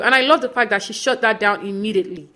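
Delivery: ordinary talk, nothing marked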